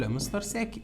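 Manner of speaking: soft, lightly voiced student reply; student answering. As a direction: answer the teacher quietly, as a pupil. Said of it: "هتقول لي".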